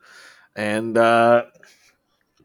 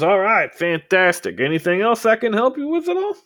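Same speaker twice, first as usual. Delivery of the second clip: much higher, chirpy salesman voice; eager shopkeeper; 11 semitones higher, 6 dB louder than usual. Acted. Shopkeeper thanks a paying customer, much higher and eager. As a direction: sell it, beaming with a lifted, high voice.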